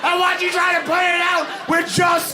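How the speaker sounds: very high, full-out crazed scream; ironically "calm" hysterical shriek; mock-calm screaming threat. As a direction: scream the threat, completely unhinged. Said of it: "And very calmly".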